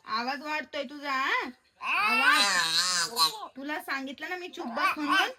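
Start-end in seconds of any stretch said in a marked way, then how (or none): 1.8-3.3 stern shout. Real, not acted. Mother scolding child for yelling.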